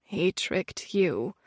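soft, slow and low; sad. A narrator's reading. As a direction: say it sadly and slowly. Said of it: "with a sad gaze".

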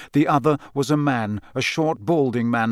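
usual speaking voice

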